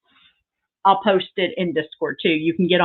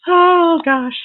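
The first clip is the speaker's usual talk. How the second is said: excited gasp; 9 semitones higher, 5 dB louder than usual. Real, not acted. Reacting to a gift.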